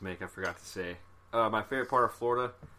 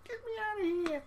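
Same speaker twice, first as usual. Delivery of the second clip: mock toddler squeal, much higher; scared toddler; 5 dB quieter than usual. Dad imitating his daughter scared of the ocean, high squeal. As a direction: squeak it like a frightened little girl.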